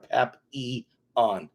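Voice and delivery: Papillon said slowly as pa-pee-on, no L; very slow model pronunciation; careful pronunciation. Shown as a demonstration. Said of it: "That's the best way to pronounce it".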